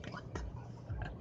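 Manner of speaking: whispered in disbelief; stunned whisper. Real, not acted. Whispering to camera in shock at police at door. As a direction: breathe it out, shocked.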